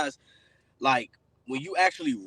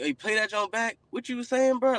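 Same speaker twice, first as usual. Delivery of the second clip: higher-pitched, eager imitation of a friend; impressed friend; 7 semitones higher than usual. Friends reacting to his personal songs, imitated with impressed excitement. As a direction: mimic a friend wanting to hear more.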